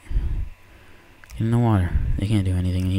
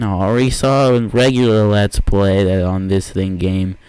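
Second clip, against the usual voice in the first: usual speaking voice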